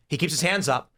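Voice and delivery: ordinary delivery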